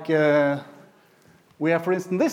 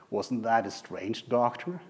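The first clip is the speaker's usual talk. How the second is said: soft, slow, lower aside; retold technician's question; 4 semitones lower, 8 dB quieter, slower than usual. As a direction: ask it softly and slowly. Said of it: "the technician said".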